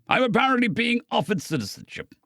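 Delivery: awkward. A narrator's reading. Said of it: "recovered awkwardly".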